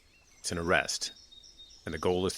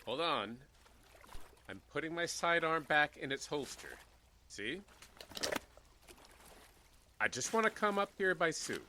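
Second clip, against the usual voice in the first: calm reassurance; 6 semitones higher, 7 dB quieter than usual. Acted. Sheriff calming the armed man.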